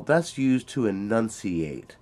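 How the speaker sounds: ordinary talk, nothing marked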